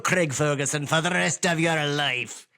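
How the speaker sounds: hammed-up English-Irish wizard; theatrical wizard voice drifting into Irish; evil wizard. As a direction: pronounce a curse in a wandering English-Irish accent. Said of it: "your evil wizard was English and also Irish".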